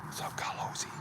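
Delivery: soft real whisper. Real, not acted. Greeting a friend quietly in the dark woods, whispered. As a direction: breathe it so the woods stay still.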